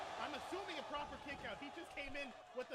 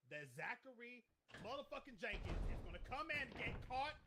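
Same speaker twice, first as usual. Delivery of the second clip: ordinary talk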